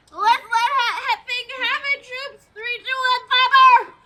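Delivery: loud countdown order to fire; commander's shout. Acted. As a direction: bark the countdown at the troops.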